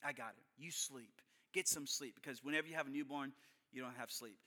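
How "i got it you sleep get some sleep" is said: soft offer to wife. Retold or imitated. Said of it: "I said".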